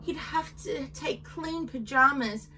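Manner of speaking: usual speaking voice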